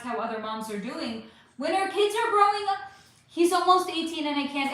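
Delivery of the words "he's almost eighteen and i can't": ordinary talk, nothing marked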